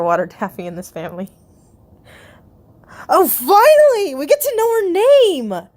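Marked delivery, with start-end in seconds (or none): 3.1-5.7 excited outburst. Real, not acted. Reader finally learns a character's name, excited outburst. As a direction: burst out high and stretch the words in real excitement.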